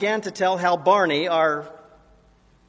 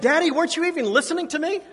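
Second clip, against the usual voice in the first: put-on much higher and fast, a teen's outraged cry; exasperated daughter exclaiming; 11 semitones higher, 4 dB louder, much faster than usual. Daughter to her father who missed her story, high exasperated exclaim. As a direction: throw it at dad in a rush, pitched way up. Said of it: "She exclaimed".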